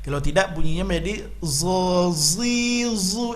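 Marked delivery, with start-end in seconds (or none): 1.4-3.3 "zo, zi, zu" with tongue kept inside, a heavy z; wrong zo. Shown as a demonstration. Teacher demonstrating the thick z error instead of dho. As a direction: show the error: say "zo" without the tongue out. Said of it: "Itu huruf zal yang ditebalkan".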